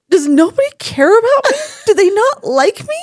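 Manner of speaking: high, loud mock wail; imitating tearful upset. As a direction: wail it like a hurt, groggy patient. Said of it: "and she starts crying".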